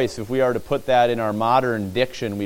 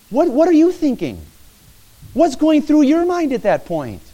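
urgent questioning; 13 semitones higher, 5 dB louder than usual. Real, not acted. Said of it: "put yourself there".

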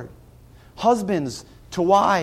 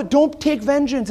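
ordinary delivery

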